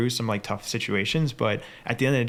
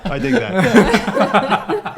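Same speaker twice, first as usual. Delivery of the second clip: laughing; 11 semitones higher, 11 dB louder, much slower than usual. Real, not acted. Laughing reaction to the accent.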